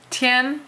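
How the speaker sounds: tian held high and level; first tone tian. Shown as a demonstration. Teacher demonstrates first tone tian, high and level. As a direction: sing tian on one high note. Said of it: "you're singing".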